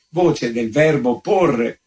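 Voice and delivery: usual speaking voice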